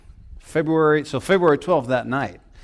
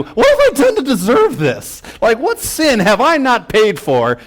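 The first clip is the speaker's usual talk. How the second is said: much higher, pleading voice; mock despairing plea; 8 semitones higher than usual. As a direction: beg heaven, half-hammed. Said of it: "I'm thinking".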